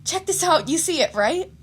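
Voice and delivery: high, loud eager call; excited girl voice. Acted. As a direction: show off the photo, bubbling over. Mina excitedly showing phone.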